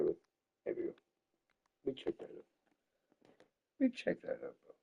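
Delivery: barely voiced, very soft; hushed aside. Real, not acted. Streamer checking the screen setup, in a hushed aside. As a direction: say it under the breath to yourself.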